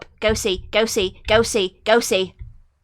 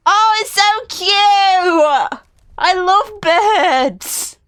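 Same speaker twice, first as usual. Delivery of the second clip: higher, slow, adoring gush; 6 semitones higher, much slower than usual. Real, not acted. Gamer gushing over the bird, adoring and slow. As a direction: melt over something cute.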